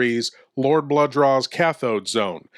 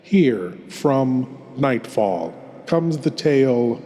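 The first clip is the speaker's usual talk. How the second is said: horror host. Acted. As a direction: draw the listener into the dark, savouring each word. Horror host introduces tale.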